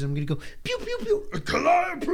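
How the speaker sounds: zap from the bushes and cry the spell. Retold or imitated. Player hiding behind bushes zaps and shouts, high. Replaying his spell attack.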